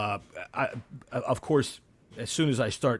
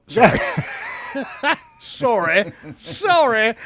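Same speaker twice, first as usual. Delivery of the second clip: laughing apology; 9 semitones higher, 5 dB louder, slower than usual. Real, not acted.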